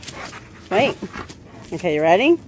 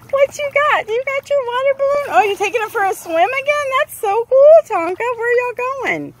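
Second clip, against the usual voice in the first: pet talk; 8 semitones higher than usual. Real, not acted. Owner cooing at her dog in high baby talk.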